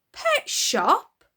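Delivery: high, loud disbelieving question; hammed-up stunned father; shocked echo. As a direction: echo it in horror.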